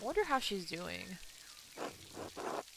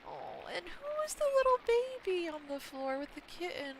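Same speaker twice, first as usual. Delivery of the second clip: gushing coo; 9 semitones higher than usual. Real, not acted. Gamer spotting the baby with the kitten, high cooing delight.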